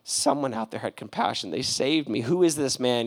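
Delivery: patient quietly thanking God for his rescuer; hushed gratitude. Retold or imitated. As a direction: murmur it in quiet awe. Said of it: "And he's like".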